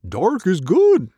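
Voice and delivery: loud, high, slow dog. A narrator's reading. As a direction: reassure the others loudly as the dog. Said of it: "Dog told them".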